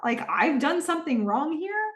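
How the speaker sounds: high-pitched, put-on incredulity rising into a question; imitated "who, me?" disbelief; mock innocence. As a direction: act affronted and innocent, drawing out "saying me". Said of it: "they're just pointed up, like".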